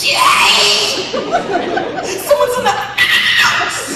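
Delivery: high, slow, strangled whisper-scream; imitating a terrified wife's whisper-scream. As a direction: scream it under your breath. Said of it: "she whispers screams".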